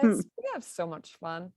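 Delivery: ordinary talk, nothing marked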